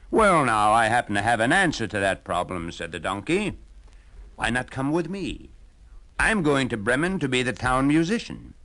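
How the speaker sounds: a jolly donkey selling his plan; hearty old donkey. Acted. Donkey invites the hound.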